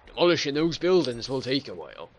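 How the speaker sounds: normal talking voice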